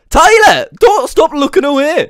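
high yelp of the name, easing after; exasperated call. Pretend. Leprechaun scolds Tyler for looking away, high exasperated call. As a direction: shout the name in mock outrage.

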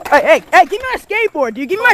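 loud call. Real, not acted. Kid yelling for his board.

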